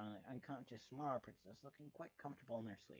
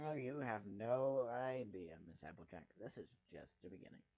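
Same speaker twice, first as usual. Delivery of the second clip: sinister villain; 3 semitones lower than usual. Acted. Villain threatens Applejack.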